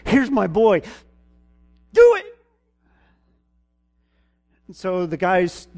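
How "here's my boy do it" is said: a father at the end of his rope shouting; desperate demand. Retold or imitated. Father shoving his son forward, shouting at disciples. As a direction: voice leaps to a loud cry on do it.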